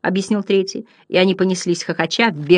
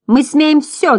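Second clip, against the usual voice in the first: high, defiant clown cry; shouting; 9 semitones higher, 4 dB louder, slower than usual. A narrator's reading. Second clown butterfly to the others, high cheeky shout. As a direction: crow it out high. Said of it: "закричал другой".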